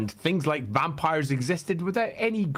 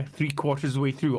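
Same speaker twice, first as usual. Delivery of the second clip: normal talking voice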